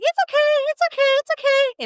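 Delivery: very high, put-on soothing voice; frustrated inside, squeak out forced reassurance; mock fake-cheery falsetto. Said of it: "why are you using that tone of voice".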